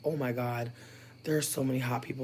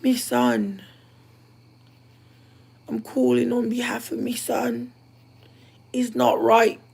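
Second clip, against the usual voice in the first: higher, louder, slow Irish mammy; rural Irish accent; 11 semitones higher, 10 dB louder, slower than usual. Acted. Calling about her son's debts, rural Irish accent. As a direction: play a distraught rural Irish mother. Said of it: "I was trying to give rural Irish".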